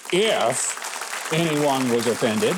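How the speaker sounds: drawn-out quote at half speed; mock apology. Commentator quoting the organizers' pathetic non-apology, drawn out mockingly. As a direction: stretch out the non-apology, slowly. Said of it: "when you say".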